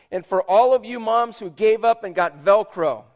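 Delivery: delivered with a laugh. Real, not acted. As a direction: share the joke with the crowd. Joke in tribute, laughter.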